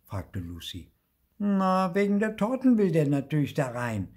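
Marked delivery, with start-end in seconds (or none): 1.4-4.1 loud, high know-it-all; butting in. A narrator's reading. Marti answers for the little ghost, butting in. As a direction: cut in loudly with the obvious answer. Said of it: "mischte sich Marti ein".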